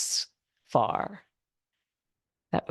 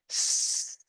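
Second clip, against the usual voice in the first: long unvoiced snake hiss; drawn-out s like a snake; 4 dB quieter than usual. Shown as a demonstration. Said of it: "make a hiss sound like snake".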